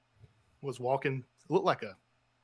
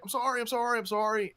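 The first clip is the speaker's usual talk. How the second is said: put-on scared kid; 10 semitones higher than usual. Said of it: "And he's like".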